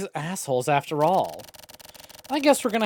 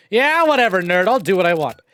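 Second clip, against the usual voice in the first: high, loud bratty retort; loud snotty brat voice; mocking defiance; 3 semitones higher, 5 dB louder than usual. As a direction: sass the nerd like a rebellious teen. Mocking defiance at game character.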